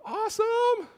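very high, drawn-out squeal of a word; mock-delighted thought. Teen thinking he'll get off the ticket, mock delight. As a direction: hold it in a comic falsetto. Acted hopeful thought while awaiting judge.

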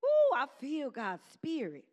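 ordinary talk, nothing marked

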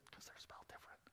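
quick, soft conspiratorial whisper; fast hushed whisper shared with the congregation; whispered aside. Real, not acted. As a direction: lean in and whisper it fast like a secret. Aside to congregation about spelling.

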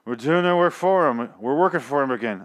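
mock slurred, run-together words; slurred blurt. Imitating the actress who plays Maggie, slurred blurt. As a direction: blurt it out sloppily, mouthing it indistinctly. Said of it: "she's kinda slurring".

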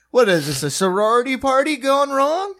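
judge's hammed-up shocked question; mock incredulity. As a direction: act amazed at the room, half-joking. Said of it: "his response was".

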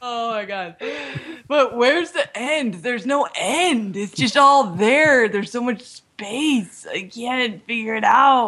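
slow and awed, drawn out; slow stoned wonder. Pretend. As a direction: muse dreamily at the void. Stoned awe at space.

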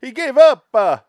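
theatrical mock Italian wail. Friend mocking Mussolini's surrender, hammed-up Italian voice.

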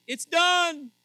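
high, booming proclamation; loud voice from the temple. Retold or imitated. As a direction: call it out as a thundering decree. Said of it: "came a loud voice".

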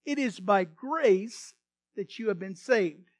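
slow, loud scripture proclamation; fervent preaching. Acted. Preacher declaring scripture to congregation, slow and loud. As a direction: proclaim each word like a sermon.

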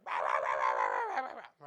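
loud mock barking, starting very low; imitated dog barking. Dogs going nuts as the van pulls in, imitated barking. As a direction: bark wildly like excited dogs. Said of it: "the dogs start".

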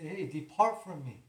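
firm loud command to depart; commanding quote. Retold or imitated. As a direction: order the enemy away. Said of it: "and say".

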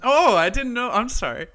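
loud, high-pitched excuse; protesting apology. Real, not acted. Co-host scolded for ruining the dramatic break, loud protest. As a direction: protest loudly that you had no idea.

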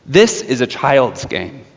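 usual speaking voice

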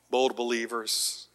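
usual speaking voice

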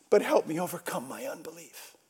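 soft, quick confession of doubt; hushed plea. Retold or imitated. Father admitting doubt to Jesus, hushed plea. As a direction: drop to a soft, hurried plea.

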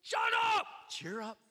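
high shouted shut up, then a lighter cheer up; the crowd flipping from hushing to encouraging, shouted then upbeat; crowd switching tone. Acted. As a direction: bark it, then turn encouraging. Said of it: "Look at how they change".

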